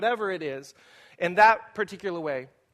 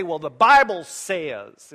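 slow, drawn-out Southern drawl, loud on BIBLE; Southern accent; 8 dB louder, slower than usual. Acted. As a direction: put on a Southern preacher-style twang, stretching "says". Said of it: "I'm sorry for my southern accent".

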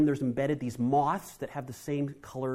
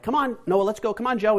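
calling out; 6 semitones higher, 5 dB louder than usual. Retold or imitated. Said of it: "I'm like".

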